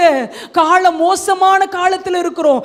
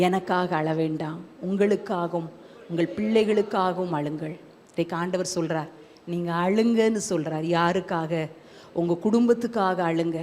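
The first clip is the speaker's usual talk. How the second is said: very low, soft, solemn delivery; hushed preaching; 10 semitones lower, 8 dB quieter than usual. Real, not acted. Preacher recounting the Lord's words on weeping, hushed. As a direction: bring it down to a tender hush, leaving space between phrases.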